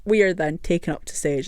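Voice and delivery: ordinary talk, nothing marked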